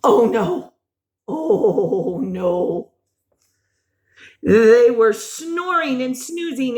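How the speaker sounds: usual speaking voice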